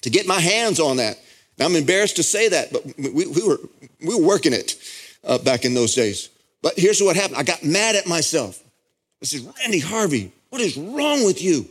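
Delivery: high, ringing sermon voice at full force; fervent pulpit delivery; impassioned preaching. Acted. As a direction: preach it, swept up in the moment.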